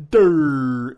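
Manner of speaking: loud, drawn-out dopey "durr"; mock dimwit. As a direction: blurt it out like a dimwit. Mocking dumb reply in retold exchange.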